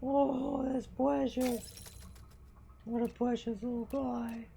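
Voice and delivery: high, slow, doting coo; cooing at the cat. Real, not acted. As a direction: coo over a sleeping kitten.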